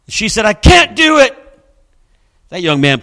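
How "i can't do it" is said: high, loud, breaking protest; desperate high outcry; overwhelmed refusal. Retold or imitated. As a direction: cry out that she can't.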